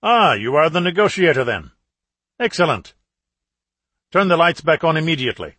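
imperious caller on a speakerphone; commanding terrorist. A narrator's reading. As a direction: announce yourself as the man in control. Terrorist leader's voice.

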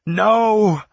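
very high, loud wail; anguished scream. A narrator's reading. Narrator sees Tallow fatally wounded, anguished scream. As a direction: cry out in horror, drawn out. Lover struck down, horrified cry.

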